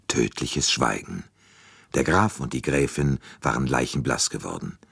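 ordinary delivery, nothing marked

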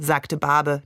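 ordinary delivery

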